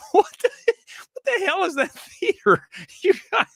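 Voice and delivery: high, laughing, incredulous outburst; laughing disbelief. Real, not acted. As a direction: crack up at the absurd theater name. Laughing at theater name.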